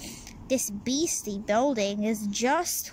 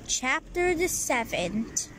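usual speaking voice

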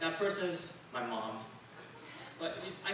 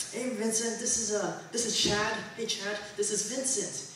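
higher, upbeat introduction voice; friend's intro voice; 6 semitones higher than usual. Retold or imitated. Friend introducing Vincent to Chad, lightly voiced chirpy intro. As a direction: mimic the friend chirpily introducing two people.

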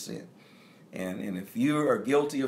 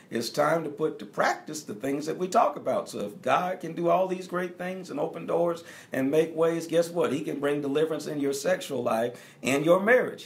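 full-force preaching; 7 semitones higher than usual. Acted. Pastor rousing the congregation, preaching at full force. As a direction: lift the church, proclaim it.

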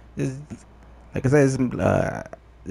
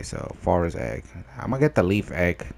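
ordinary talk

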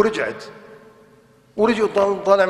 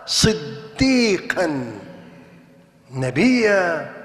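very slow emphasis; 3 semitones lower, much slower than usual. Acted. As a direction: stretch صديقا and نبيا out very slowly. Drawing out the Quranic titles.

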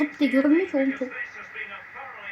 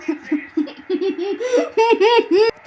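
high, helpless laughing fit; burst of laughter; 7 semitones higher than usual. Real, not acted. Gamer laughing at his keeper's save, laughing fit. Laughing at own goal scare.

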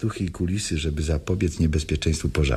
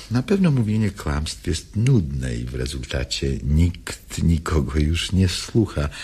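ordinary talk

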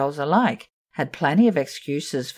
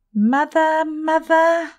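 put-on high small girl's voice calling for mother; a frightened child's sigh for her mother; 11 semitones higher, 4 dB louder, slower than usual. Meg Giry sighs for her mother, high and childlike. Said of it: "Meg Giry sighed".

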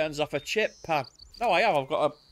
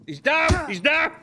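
high, loud excited shout; excited callout; 12 semitones higher, 5 dB louder than usual. Real, not acted. Player calling a downed enemy to his squad, excited shout. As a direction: call it out to the squad, urgent.

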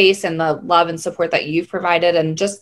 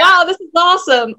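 higher, louder gushing wow; mock delighted wow; 10 semitones higher, 3 dB louder than usual. To her morning self, a higher, delighted gush. Laughing through morning meditation.

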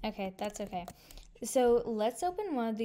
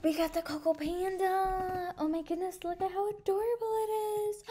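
much higher, thrilled gushing; excited squeal; 9 semitones higher, 4 dB louder than usual. Real, not acted. Player squealing at her new panda, high and thrilled. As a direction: squeal with joy at the prize.

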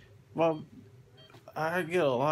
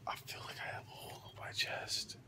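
whispering; 13 dB quieter, faster than usual. Real, not acted. Post-surgery, drugged and groggy.

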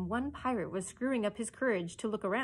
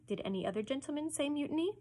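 quick, higher polite question; courteous; 7 semitones higher, faster than usual. A narrator's reading. Hook challenges the remaining crew, courteous. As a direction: ask with silky politeness. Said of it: "Hook asked courteously".

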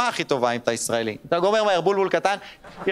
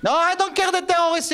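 excited outburst; 11 semitones higher than usual. Acted. Excited outburst about the rockets.

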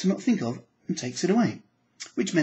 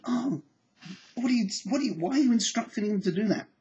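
mock exasperation; 8 semitones higher than usual. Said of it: "mock exasperated question".

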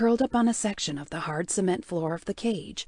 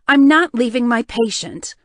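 defiant loud; 3 semitones higher, 9 dB louder than usual. Acted. She refuses to leave patient.

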